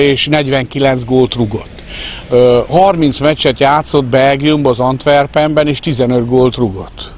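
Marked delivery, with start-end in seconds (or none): none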